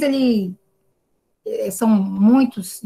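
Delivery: usual speaking voice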